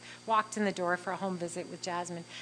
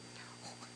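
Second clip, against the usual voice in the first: retold gasp; 17 dB quieter than usual. Dread at joining the meeting.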